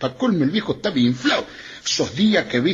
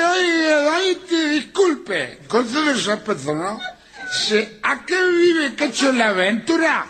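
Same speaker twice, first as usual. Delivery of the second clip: put-on drunk pleading; 9 semitones higher than usual. Drunk man asking at door.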